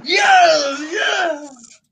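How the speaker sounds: high cheering yes; excited yes. Real, not acted. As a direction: cheer in triumph. Guess was right.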